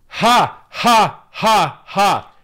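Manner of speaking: loud, high, separate ha ha ha; loud bitter laugh. Real, not acted. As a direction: laugh out in scornful disbelief.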